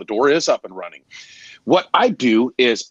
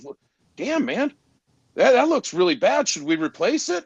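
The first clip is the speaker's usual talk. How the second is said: customer shocked; 9 semitones higher than usual. Retold or imitated. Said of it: "He goes".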